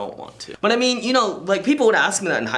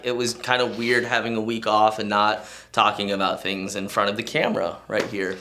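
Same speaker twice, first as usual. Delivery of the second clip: usual speaking voice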